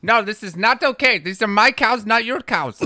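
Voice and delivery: loud, high indignant Indian accent. Shown as a demonstration. Trader disputes whose cows these are, Indian accent. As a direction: protest in a hammed-up Indian accent. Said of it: "I was working on an Indian one".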